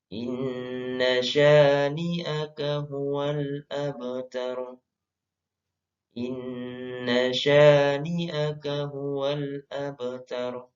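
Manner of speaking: slow, measured Quranic recitation. Shown as a demonstration. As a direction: recite slowly, stretching the held sounds for learners to follow. Recites the last verse. (mengaji tartil, pelan)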